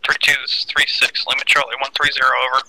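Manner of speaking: radio voice. Real, not acted. Radio reply over comms.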